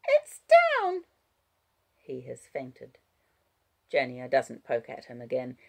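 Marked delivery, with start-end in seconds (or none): 0.1-1.0 put-on very high, loud child voice; child's retort. A narrator's reading. Child correcting fading Wallace, high child voice. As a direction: point it out as a small girl.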